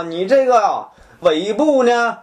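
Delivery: ordinary talk, nothing marked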